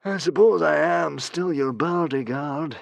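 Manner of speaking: slow, grand hero voice; reluctant bodyguard resigned; lightly voiced grand ex-hero; Humongous. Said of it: "said Humongous".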